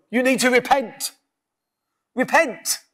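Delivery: mock a finger-wagging preacher; a hint of a stern church voice telling sinners to repent; preachy scold. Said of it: "They go".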